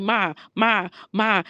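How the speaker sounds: lightly imitated nagging call for mom; mock insistent calling; pestering kids. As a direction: repeat it like a child wanting attention. Imitating kids pestering her for attention.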